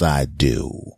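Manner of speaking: ordinary delivery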